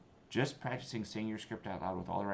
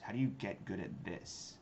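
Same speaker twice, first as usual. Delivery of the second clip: ordinary talk, nothing marked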